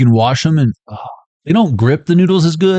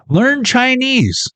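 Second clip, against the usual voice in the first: high slow catchphrase; 11 semitones higher, much slower than usual. Acted.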